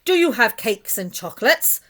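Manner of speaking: loud, eager greedy call; greedy cry. A narrator's reading. Rat calls into the cave, loud greedy cry. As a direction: shout it into the cave. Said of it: "the highway robber cried".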